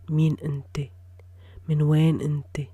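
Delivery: Min Nte spoken out in full voice, stronger; voiced aloud. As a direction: say Min Nte out loud, firmly this time. Repeated aloud after whisper.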